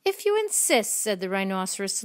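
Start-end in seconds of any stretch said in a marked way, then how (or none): 0.1-0.9 high, lilting storybook reply; obliging reply. A narrator's reading. Creature accepting the ladybug's challenge, high and lilting. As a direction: accept the dare in a raised voice.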